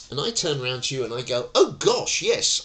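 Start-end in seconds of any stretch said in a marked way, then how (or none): 1.5-2.6 much higher and louder, gushing; overeager janitor. Pretend. Janitor answers the shouting tenant, gushing and theatrical. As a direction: burst out with theatrical delight. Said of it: "I probably wouldn't go".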